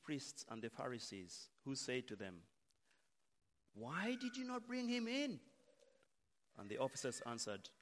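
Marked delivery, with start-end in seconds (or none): 3.7-5.4 high-pitched demand from an irked superior; demanding boss. Acted. Chief priests question returning guards, demanding high-pitched voice. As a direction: put on a high voice and demand an explanation.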